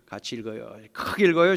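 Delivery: usual speaking voice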